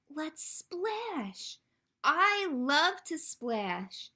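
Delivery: high, bouncy animal lines and drawn-out splashes; animated storytime reading. A narrator's reading. Librarian reads frog and duckling splashing together, animated storytime delivery. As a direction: play up the splashing for small children, slowing on the last words.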